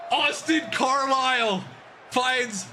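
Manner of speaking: high shouted play-by-play call; excited play-by-play. Real, not acted. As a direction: call the play at the top of your lungs. Calling the winning play.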